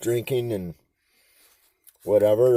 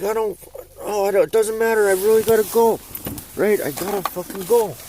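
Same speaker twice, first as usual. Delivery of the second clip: higher, strained mock pleading; desperate plea; 10 semitones higher than usual. Teen pleading with the mom to stop for a pee, mock strained. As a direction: squeeze out that you really need to go. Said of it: "I'm like".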